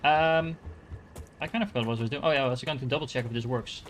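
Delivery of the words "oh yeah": ordinary talk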